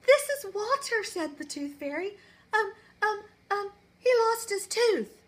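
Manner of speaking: put-on high, hesitant boy voice; nervous little boy. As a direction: stammer it like a shy kid.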